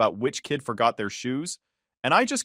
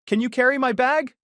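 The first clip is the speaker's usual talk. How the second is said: put-on little girl voice; 12 semitones higher, 4 dB louder than usual. Child Sophie asking.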